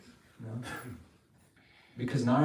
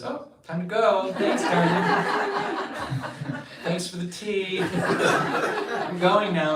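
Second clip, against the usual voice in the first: higher, much louder, laughing through the farewell; giggly sly goodbye; 8 semitones higher, 10 dB louder, much slower than usual. Acted. Narad leaving after stirring trouble, loud laughing goodbye. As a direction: Narad slips out gleefully, very slow on the thanks.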